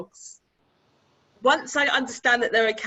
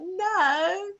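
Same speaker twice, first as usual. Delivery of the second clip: high-pitched, drawn-out no; unconvincing denial; 11 semitones higher than usual. Retold or imitated. Man assuring the dog owner he stays, falsely innocent high denial. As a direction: sing the denial up high, plainly untrue. Acted denial when asked.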